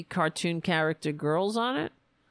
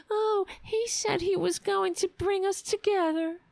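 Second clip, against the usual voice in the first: much higher whiny mock voice; mocking right-winger cry; 12 semitones higher than usual. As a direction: whine it like a crybaby, high. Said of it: "right-wingers cry".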